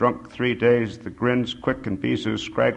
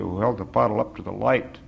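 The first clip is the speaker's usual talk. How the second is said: plain reading voice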